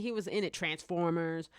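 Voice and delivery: ordinary talk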